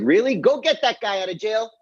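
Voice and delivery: king urgently ordering the prisoner brought out; eager Pharaoh. Retold or imitated. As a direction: jump at the idea, impatient to act. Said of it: "Pharaoh's like".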